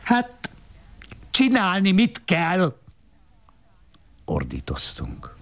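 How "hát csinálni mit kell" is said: high sharp retort; snapping wife. Acted. The wife retorting to her husband, sharp and high. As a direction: fire it back, pitched high.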